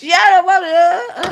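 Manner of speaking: high, loud, slow wail; crying shout. Real, not acted. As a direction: cry it out.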